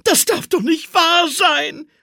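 sehr hoher, lauter Jubel; jubelnd. A narrator's reading. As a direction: jubel vor Freude. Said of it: "jubelte Olaf".

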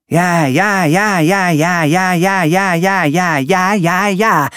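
high, rattled-off ja ja of a grumpy villain; villain's rapid yeses. Acted. Alan answering the door, high rattling yeses. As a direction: fire off every ja like a stuck doorbell.

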